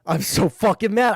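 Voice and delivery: real frustration boiling up; mad, rising. Real, not acted. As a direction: let the anger climb on the repeat.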